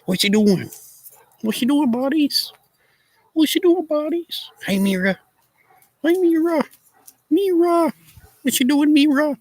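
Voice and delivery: very high, sing-song pet voice. Real, not acted. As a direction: coo at the puppies, calling them. Calling to her dogs.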